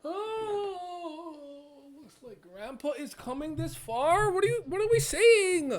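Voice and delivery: loud, very high exclamation; hammed-up bafflement. Baffled reader cries out for help with the English, hammed-up high wail.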